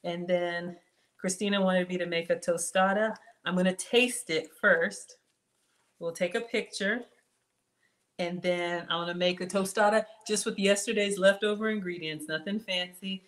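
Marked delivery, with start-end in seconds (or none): none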